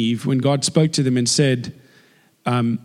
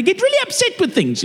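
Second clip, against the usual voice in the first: very high, front-of-mouth South African whine; mock upset South African, pitch shooting up; squeaky forward South African accent. Shown as a demonstration. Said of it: "South Africans speak in the front of their mouths".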